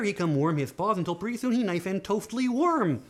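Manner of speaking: ordinary delivery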